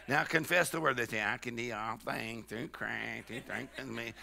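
very quiet, low, whiny confession; mock whine. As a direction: mimic someone weakly whining a Bible verse. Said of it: "get that whine out of your voice".

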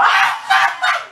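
very high mock shrieks; repeated crazed screams, acted out; imitated screaming. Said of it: "y ella gritaba".

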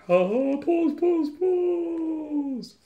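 high, loud, drawn-out teasing cry; mock-gleeful taunt. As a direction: shriek it playfully, stretching the final word. Teases viewers showing the code.

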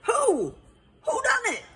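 high-pitched exclaimed question; crying out. A narrator's reading. Detective still seeking the culprit, crying out. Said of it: "Hubert cried".

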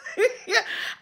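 soft high wheezing laugh; breathless high laugh. Real, not acted. Still laughing.

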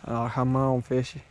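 ordinary talk, nothing marked

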